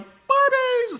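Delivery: extremely high, loud squeal; child shrieking for Barbies; glass-shattering squeal. Retold or imitated. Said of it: "her voice is going, you know, glass would shatter".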